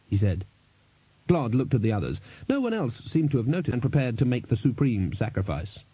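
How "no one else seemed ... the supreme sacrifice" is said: ordinary delivery, nothing marked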